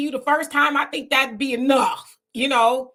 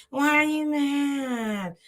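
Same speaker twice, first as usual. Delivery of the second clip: slow, higher, girlish mock of her whine; drawn-out girly "why are you mad"; young girl's voice; 3 semitones higher, much slower than usual. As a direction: imitate her sounding young and puzzled. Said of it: "that's how she talks".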